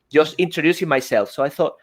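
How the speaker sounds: ordinary talk, nothing marked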